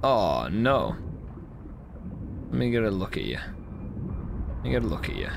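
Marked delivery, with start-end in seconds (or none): 3.4-5.3 whispered, slow and low; soft whisper. Acted. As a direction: breathe it out gently. Repeated softly, whispered.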